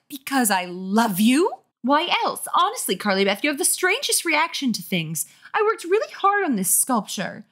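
wounded mother pleading her case in a high voice; exasperated mother. Acted.

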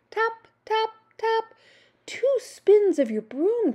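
very high small voice; little monster. A narrator's reading. Little monster directing the broom spell, high small voice. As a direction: pipe the words up high.

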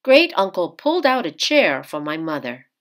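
ordinary delivery

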